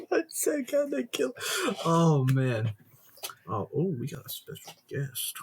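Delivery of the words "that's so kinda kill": high squeaky laugh through words; words squeezed out mid laugh. Real, not acted. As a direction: laugh helplessly at the rant. Laughing at the rant.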